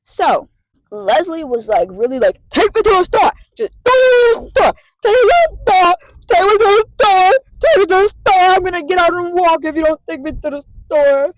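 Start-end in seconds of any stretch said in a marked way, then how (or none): 2.5-11.3 high, loud, whiny yelling at the driver; demanding yell. Retold or imitated. Patient yelling at the driver to stop at the store, whiny high shout.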